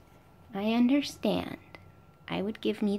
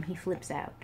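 ordinary delivery

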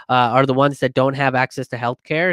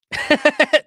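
quick high chuckle; brief burst of high laughter; short laugh; 13 semitones higher, 3 dB louder than usual. Real, not acted. Host reacting to Democracy Now's journalism, short laugh.

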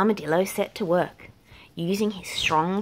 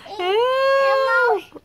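loud, very high panicked cry; cry for help; 7 dB louder than usual. A narrator's reading. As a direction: scream it as you fall. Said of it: "cried Armadillo".

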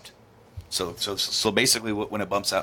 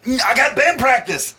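high and loud whiny put-on voice; mock whiny excuse; 12 semitones higher, 5 dB louder than usual. Mocking the guy dodging her, high and loud. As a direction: whine the excuse in a silly voice. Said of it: "he's like".

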